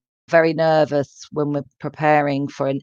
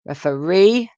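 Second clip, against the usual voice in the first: "referee" with the last syllable stressed, refe-REE; stress on third syllable. Shown as a demonstration. Teacher showing similar words apart, "referee" stressed on the third syllable. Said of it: "with a stress on the third syllable".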